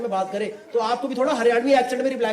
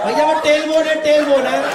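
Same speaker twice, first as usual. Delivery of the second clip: louder, higher frantic plea; frantic, loud, high plea to the gunman; panicked pleading; 4 semitones higher, 6 dB louder than usual. Retold or imitated. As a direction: desperately correct the gunman.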